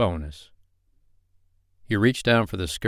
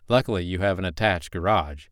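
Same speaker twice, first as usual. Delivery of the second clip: ordinary delivery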